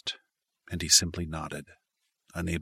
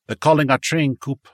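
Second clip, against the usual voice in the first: raised voice, calling over a crowd; 8 semitones higher, 8 dB louder than usual. Acted. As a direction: call it out across the platform. Calling a friend on platform.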